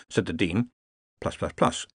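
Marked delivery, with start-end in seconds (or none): none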